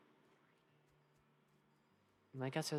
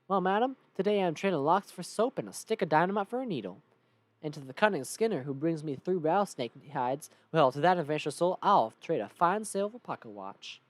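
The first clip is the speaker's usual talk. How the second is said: loud Southern huckster's pitch; showy salesman with a Southern accent; Southern trader Braid; 5 dB louder than usual. Acted. Trader pitches his deals to madam, showy Southern salesman. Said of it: "his name is Braid".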